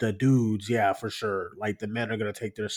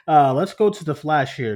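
after laughing hard; 4 semitones higher, 7 dB louder than usual. Real, not acted. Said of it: "let me collect myself here".

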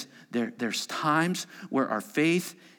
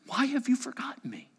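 hushed lament; 10 semitones higher, faster than usual. Acted. Psalmist's lament voiced.

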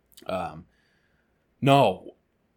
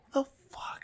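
whispered what the fuck; 12 dB quieter than usual. Retold or imitated. Lost in the hallway, confused.

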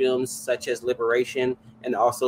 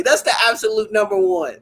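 ordinary delivery

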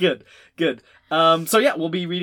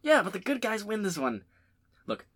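ordinary talk, nothing marked

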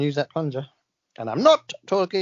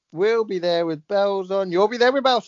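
put-on Australian; 4 semitones higher than usual. Host attempting the sign-off phrase, mock Australian. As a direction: deliver the slogan in a bad Australian accent.

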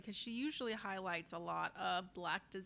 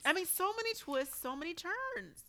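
high, animated gushing; excited; 8 semitones higher, 5 dB louder than usual. Real, not acted. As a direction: rave about it, still giggly. Laughing, gushing about the series.

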